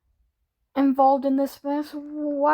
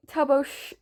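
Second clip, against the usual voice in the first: ordinary delivery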